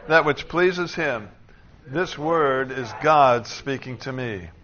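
loud, measured recitation; congregational declaration. Acted. Preacher leading the congregation in weekly confession, declaring loudly. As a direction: proclaim each line firmly, stressing the key words.

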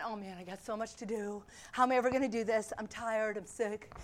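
low, soft, worn-out grumbling; mock weary complaint. Preacher voicing thoughts not to think, mock weary. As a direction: sag into a pretend tired moan.

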